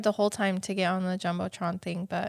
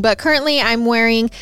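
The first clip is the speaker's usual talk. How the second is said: upbeat, loud sponsor read; 8 dB louder than usual. Acted. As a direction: sell it like a hosted podcast ad.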